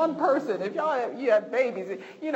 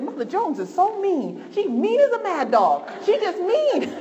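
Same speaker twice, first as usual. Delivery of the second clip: put-on teen friend quoted; 7 semitones higher, 6 dB louder than usual. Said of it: "I just remember her saying".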